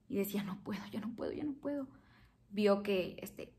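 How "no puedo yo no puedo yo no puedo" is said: breathy whisper of giving up, voice nearly gone; whispered despair. Retold or imitated. Mother shut away crying, whispering she can't go on. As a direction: whisper it like you're too tired to go on. Retelling locking herself in crying.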